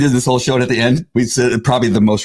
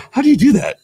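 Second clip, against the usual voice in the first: amazed question; 11 semitones higher, faster than usual. Retold or imitated. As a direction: marvel at how he does it. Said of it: "And then I go".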